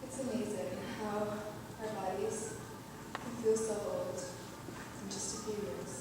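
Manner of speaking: low, soft, spent voice; subdued and inward; weary reflection. Acted. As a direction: reflect quietly, worn out. Weary reflection after despair.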